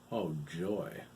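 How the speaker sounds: sarcastic. A narrator's reading. Todd sarcastic.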